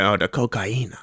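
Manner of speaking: "cocaína" with a Spanish stressed ee; imitated Spanish pronunciation. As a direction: mimic the dealer saying "cocaína" as co-ca-EE-na. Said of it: "Did he really say it like cocaína?".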